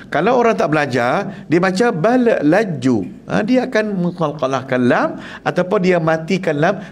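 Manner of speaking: usual speaking voice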